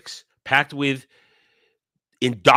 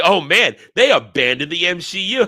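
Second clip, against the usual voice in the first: mocking fans; 4 semitones higher, 5 dB louder than usual. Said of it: "some people wanna say".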